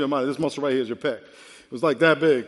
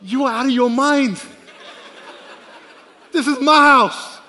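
loud, higher, amused outrage; laughing loud retort; 8 semitones higher, 9 dB louder than usual. Retold or imitated. Grandfather laughing loudly at grandson's claim. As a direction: scoff at the toddler, laughing.

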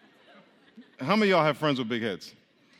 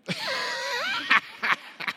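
cackling laugh. Real, not acted. Remembering a friend's big head, helpless high laughter. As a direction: crack up mid-story in a high cackle. Cracks up at own story.

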